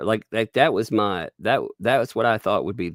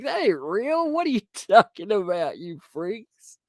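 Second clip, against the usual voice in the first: mock indignant; 11 semitones higher than usual. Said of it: "And you're like".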